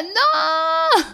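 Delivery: long, very high wailed shout; hammed-up cry of dismay, stretched out high and loud; dramatic protest. Reacting to being told to redo the take, dramatic protest.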